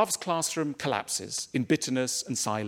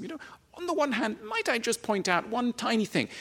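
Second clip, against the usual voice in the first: higher, fast, sugary-sweet mock politeness; mock-polite tiny criticism, higher and quicker; honeyed gentle feedback; 8 semitones higher than usual. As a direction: coo it out like tactful HR advice. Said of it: "it's gotta be ninety-nine percent honey".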